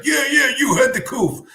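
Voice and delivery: high, loud mock voice; squeaky friend voice; imitating Ziggy. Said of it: "Ziggy's like".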